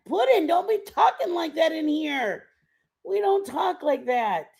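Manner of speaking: mock scolding. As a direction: playfully tell Pudding off. Scolding a viewer playfully.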